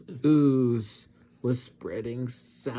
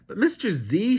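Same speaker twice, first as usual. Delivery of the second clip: high, louder whiny protest; whining; 9 semitones higher, 5 dB louder, faster than usual. Acted. Abigail protests to Mister Z, high whine. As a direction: plead like a sulky kid. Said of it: "she whined".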